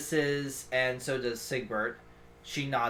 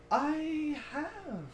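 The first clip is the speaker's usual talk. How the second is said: soft, slow regal creature; slow and hushed, amused; lightly voiced ancient guardian; 6 semitones higher, 9 dB quieter than usual.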